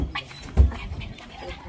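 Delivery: urgent unvoiced whisper; whispering while hiding. Real, not acted. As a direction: hiss it so they don't hear. Hiding, someone coming.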